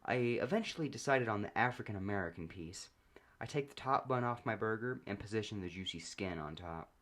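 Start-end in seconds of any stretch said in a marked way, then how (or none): none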